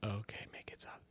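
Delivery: breathy whispered aside; real quiet whisper to yourself. Real, not acted. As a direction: drop to a soft whisper under your breath. Whispered aside while talking to Alexa.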